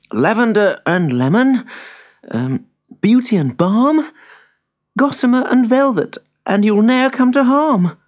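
hesitant start, building to a loud, high incantation; reciting magic words. A narrator's reading. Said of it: "read out the magic words".

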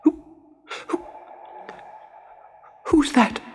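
frightened, halting, high puppet voice; stammering with fear. Acted. Pinocchio to an unseen voice in the dark, fearful high stammer. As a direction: stammer on the first word, then squeak the question. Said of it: "stammered Pinocchio, shaking with fear".

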